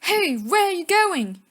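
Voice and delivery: loud, high, slow call after them. Acted. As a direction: shout after the boys running off. Malfoy calls after fleeing boys.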